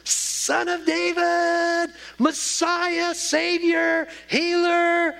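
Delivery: high, loud calling out of names; crying out. Retold or imitated. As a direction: call out to him over the crowd. Beggar crying out to Jesus.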